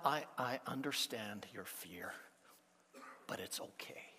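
soft, hushed reassurance fading to a whisper; tender hushed reassurance; gentle comfort. Pretend. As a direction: comfort a frightened friend, barely above a whisper. Imagined comforting Jesus, whispered.

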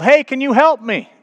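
high, loud call for help; acted yell. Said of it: "The guy inside yelled".

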